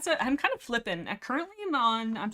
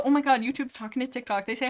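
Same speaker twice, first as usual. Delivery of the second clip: ordinary talk, nothing marked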